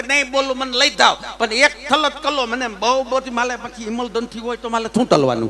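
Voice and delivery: "થલત કલો" and "માલે" with th and l sounds; childish lisp throughout; lisping Magan. Retold or imitated. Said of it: "તોતડી હોય ને".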